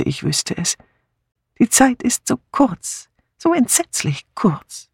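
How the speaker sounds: put-on elderly aunt's high, worried voice; high-pitched old lady fretting to herself; Miss Marple, troubled. Miss Marple troubled, time running out. (besorgte alte Tante mit hoher Stimme)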